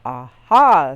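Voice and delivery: loud discovering aha; doctor's loud aha; triumphant aha. A narrator's reading. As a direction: crow it like you solved it. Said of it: "said the doctor".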